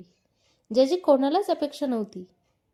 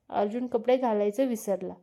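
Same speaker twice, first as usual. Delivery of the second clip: normal talking voice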